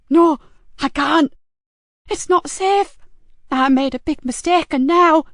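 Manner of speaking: high, loud, frightened protest; distraught caller, pitch jumping up; tearful panic. A narrator's reading. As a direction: burst out in tearful fear. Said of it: "Tears broke through".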